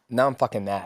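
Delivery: usual speaking voice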